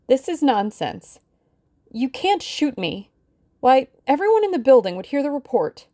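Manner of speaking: high-pitched brave protest, lightly acted; brave front. A narrator's reading. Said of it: "Her voice shook a little, but she spoke out bravely".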